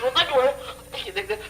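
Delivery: put-on high coaxing baby talk. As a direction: pitch way up to distract the baby. Cooing at the baby. (ऊँची आवाज़ में बच्चे को बहलाना)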